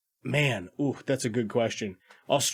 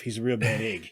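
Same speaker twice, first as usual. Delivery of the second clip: put-on New Zealand accent; 3 semitones lower than usual. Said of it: "everybody has that delightful accent".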